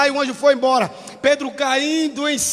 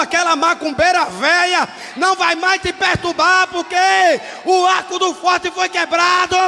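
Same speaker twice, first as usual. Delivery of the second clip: fiery preaching; 3 semitones higher than usual. Acted. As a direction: declare it over their lives with force. Preaching to the congregation.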